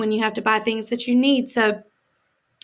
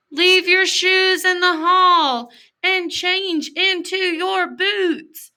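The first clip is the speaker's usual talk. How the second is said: higher, slower class announcement; teacher announcing; 8 semitones higher, 3 dB louder, much slower than usual. Acted. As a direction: call it out to the whole class like a teacher. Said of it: "the teacher announces".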